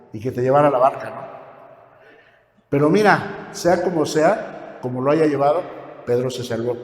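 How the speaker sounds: usual speaking voice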